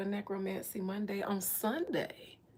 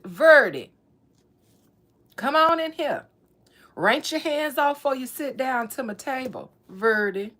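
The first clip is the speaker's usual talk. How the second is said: loud, higher grandma calling her in; playful grandma voice, much louder; 3 semitones higher, 12 dB louder than usual. Retold or imitated. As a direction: holler her in to supper like grandma.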